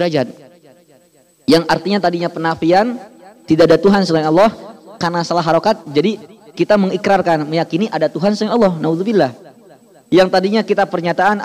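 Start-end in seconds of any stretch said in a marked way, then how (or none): none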